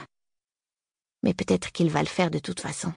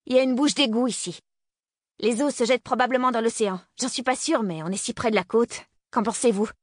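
much higher, raised taunting voice; defiant calling out; 6 semitones higher than usual. Acted. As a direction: call across the lot, defiant. Girl calls out, taunting gunman.

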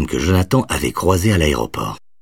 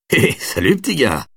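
awkward friendly greeting; 8 semitones higher than usual. A narrator's reading. Caught stoner greets the boy.

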